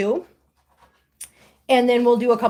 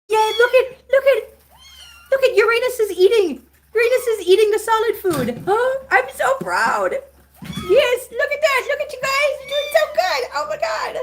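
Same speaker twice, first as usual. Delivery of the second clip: very high, laughing and teary with pride; proud squealing; 13 semitones higher than usual. Real, not acted. To the kitten Uranus eating solid food, proud teary high squeals. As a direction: gush with overwhelming pride, near tears.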